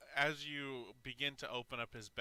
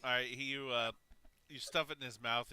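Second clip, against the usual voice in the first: ordinary talk, nothing marked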